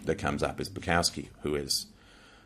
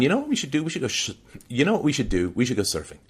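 upbeat dad; 7 semitones higher, faster than usual. Retold or imitated. Dad pitching an outing to son.

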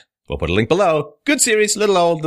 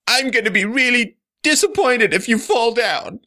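much higher, eerie put-on voice; creepy Silence of the Lambs voice; 12 semitones higher than usual. Host yells at collapsing cards in creepy high voice. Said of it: "that creepy voice from Silence of the Lambs".

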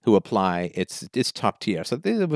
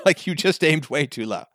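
amused; 4 semitones higher than usual. Real, not acted. Laughs at own joke.

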